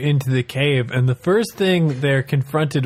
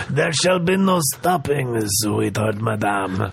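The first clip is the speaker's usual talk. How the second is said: smarmy bear voice; 4 semitones lower than usual. Acted. Bear flirting.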